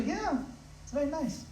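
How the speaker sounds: put-on high, soft reply in a Mombasa devotee accent. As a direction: answer softly and brightly like a Mombasa devotee. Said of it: "they'll say it in a different accent".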